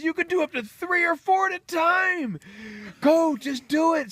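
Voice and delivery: very high, loud pep shout; urgent coaching. Acted. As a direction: drive the layer on at full pitch. Rooster urges more eggs.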